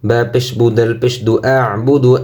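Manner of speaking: ordinary talk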